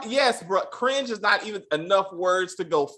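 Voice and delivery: ordinary talk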